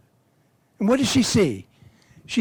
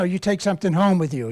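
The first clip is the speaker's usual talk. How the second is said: ordinary talk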